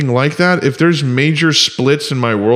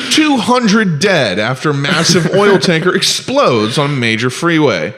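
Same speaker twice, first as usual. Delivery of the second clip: slow, raised headline read; mock news anchor; 3 semitones higher than usual. Joking news report on Batman's crash, mock anchor voice. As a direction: announce it like breaking news, hammed-up.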